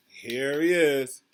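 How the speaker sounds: ordinary delivery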